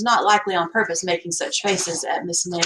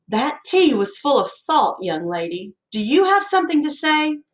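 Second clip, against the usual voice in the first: put-on stern old lady; 7 semitones higher than usual. A narrator's reading. Stern old lady scolding girl.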